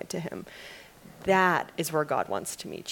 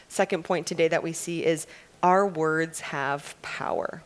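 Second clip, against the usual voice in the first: usual speaking voice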